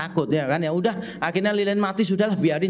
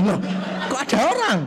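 surprised; 6 semitones higher, faster than usual. Retold or imitated. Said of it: "repeated take of surprise".

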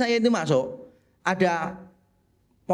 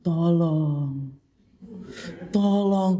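ghostly plea; much slower than usual. Retold or imitated. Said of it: "dia bilang gini".

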